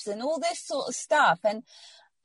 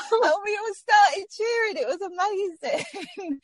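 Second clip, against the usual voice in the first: tearful joy; 11 semitones higher than usual. Real, not acted. Recalling the happy scan moment.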